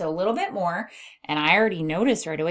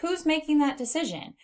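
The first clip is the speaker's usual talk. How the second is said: wondering questioner; 10 semitones higher than usual. Acted. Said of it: "So if someone's saying".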